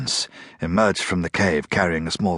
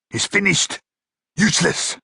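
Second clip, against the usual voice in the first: a high, raised outburst of fury; a brute bursting out in fury about the captive; sudden furious shout; 10 semitones higher than usual. A narrator's reading. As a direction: explode with contempt. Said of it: "Kranz shouted in a sudden burst of fury".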